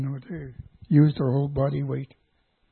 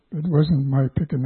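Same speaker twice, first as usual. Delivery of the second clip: ordinary talk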